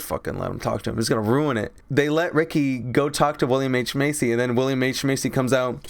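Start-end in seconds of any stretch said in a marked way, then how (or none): none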